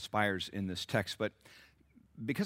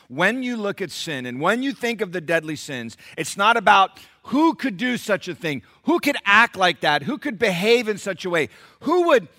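loud, high, building pulpit delivery; fiery sermon climax; full-force preaching; 9 semitones higher, 12 dB louder than usual. Acted. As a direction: hammer the rhetorical questions home.

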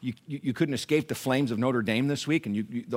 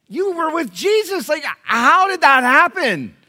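high, loud, slow mock astonishment; incredulous outburst; 12 semitones higher, 9 dB louder, slower than usual. Preacher challenging Peter over his denial, loud incredulous outburst. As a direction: cry out in drawn-out disbelief.

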